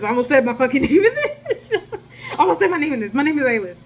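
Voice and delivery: cracking up mid-sentence; giggly, amused reversal; laughing. Real, not acted.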